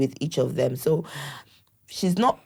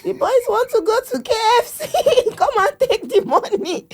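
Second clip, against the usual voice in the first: much higher, laughing mock granny voice; imitating her mother. Daughter mimics her mother giving in to the boys, high and laughing. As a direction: play the indulgent grandma, giggling through it. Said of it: "I'll just hear my mother say".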